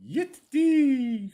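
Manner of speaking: high-pitched, loud cowboy cry; mock cowboy whoop. Teasing his Lone Star friend, mock cowboy whoop. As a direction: holler like a pretend rodeo rider.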